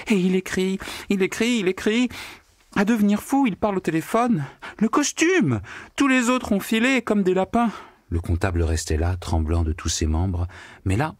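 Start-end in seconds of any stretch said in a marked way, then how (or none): 0.1-7.7 high, breathless retelling; distraught telling. A narrator's reading. Secretary retelling the cat-headed visitor to the accountant, high and upset. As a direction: rattle it off, beside herself. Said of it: "se remit aussitôt à raconter".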